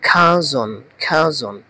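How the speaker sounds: "খাজন" as kha-zon, repeated distinctly; correct pronunciation demo. Shown as a demonstration. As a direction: drill "খাজন" slowly for learners, falling at the end. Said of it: "এটার উচ্চারণটা হবে". ("খাজন" খা-জন উচ্চারণে, আলাদা করে)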